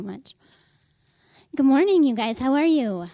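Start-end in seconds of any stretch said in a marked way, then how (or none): 1.5-3.1 loud greeting. Real, not acted. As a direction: call it out to the whole room. Greeting children loudly at church.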